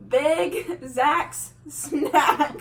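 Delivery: very slow, word by word; slow practice. Shown as a demonstration. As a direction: spell it out slowly for mom.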